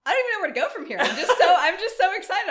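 high, loud excited squeal. Real, not acted. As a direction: gush with delighted laughter. Overjoyed at news.

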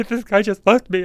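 high, loud, tearful complaint; mock crying. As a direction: wail it out like a wronged friend. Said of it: "I start crying".

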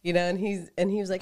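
normal talking voice

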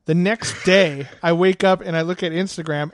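ordinary delivery

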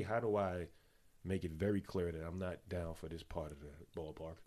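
ordinary talk, nothing marked

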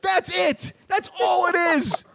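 high-pitched exasperated yell; mock outraged rant. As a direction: shriek the answer at the dumb commenter. Mock-outraged rant at thirsty commenter.

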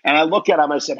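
ordinary talk, nothing marked

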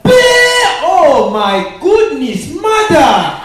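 loud, slow, laughing outburst; excited exclamation. Retold or imitated. Comic seeing the lady's bank statement, loud laughing outburst. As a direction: blurt it out in loud delight.

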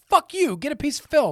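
high, loud jokey rant; mock outburst. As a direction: tell the director off in jest.

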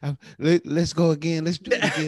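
imitated eager child begging. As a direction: plead like a child wanting another turn. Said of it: "a child on fucking monkey bars".

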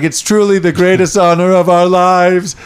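high, slow formal voice; bow and say it grandly; solemn alien greeting. Retold or imitated.

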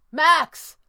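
high, loud call over the noise; yell. A narrator's reading. Red panda calling the sorcerer over shrieking, yell. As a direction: yell to be heard. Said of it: "yelled to be heard over".

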